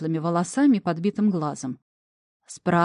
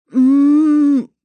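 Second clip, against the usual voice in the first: loud pitched-up muffled moan through a gag; loud gagged mooing; 6 semitones higher, 10 dB louder than usual. A narrator's reading. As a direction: moan hard with mouth stuffed. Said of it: "как можно более громко и выразительно промычала".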